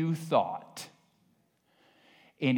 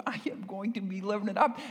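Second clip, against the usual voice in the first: excited; 5 semitones higher, 4 dB quieter, much faster than usual. Real, not acted. Joy about heaven.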